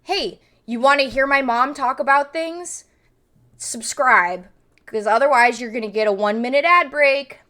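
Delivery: loud, punchy ad announcer read. Acted. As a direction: sell it like a loud stream ad. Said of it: "we have to do a little ad here".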